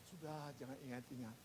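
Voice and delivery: very soft, low voice; quietly soothe, as God speaking to someone; hushed, low counsel; acted gentle reassurance. Acts God speaking gently to creditor.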